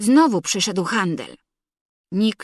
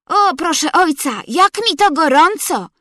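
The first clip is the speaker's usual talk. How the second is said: put-on excited boy's shout; 6 semitones higher, 5 dB louder than usual. A narrator's reading. Felek shouts to father.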